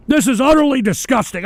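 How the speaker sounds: loud, high, indignant disgust; disgusted outburst. Real, not acted. Host refusing to show viewers the material, disgusted outburst. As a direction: raise the voice in revulsion.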